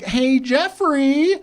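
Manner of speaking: high, slow, drawn-out mom voice; high-pitched nagging mom impression; mom on the phone. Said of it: "My mom calling me out of nowhere".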